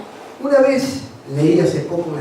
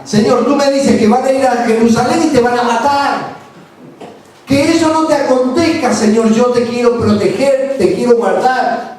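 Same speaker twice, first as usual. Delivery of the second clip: higher, louder loving plea; Peter pleading; 5 semitones higher, 3 dB louder than usual. Retold or imitated. Peter begging Jesus not to die, higher and louder. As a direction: protect him with every word. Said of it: "lo que Pedro dice".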